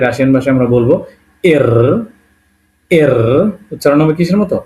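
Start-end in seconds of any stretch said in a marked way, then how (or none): 1.4-3.1 "এর" with a drawn-out র sound; "এর" ending on a sustained Bengali-style r; letter-name demo. Shown as a demonstration. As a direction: say "এর", holding the final r. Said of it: "র এর মতো".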